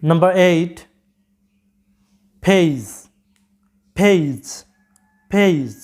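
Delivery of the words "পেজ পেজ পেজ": পেজ as payj, gliding vowel, puffed p; correct pronunciation. Shown as a demonstration. Teacher introduces word eight to students, correct pronunciation. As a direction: model পেজ English-style, falling. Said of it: "সঠিক উচ্চারণটা হচ্ছে".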